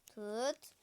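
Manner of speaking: normal talking voice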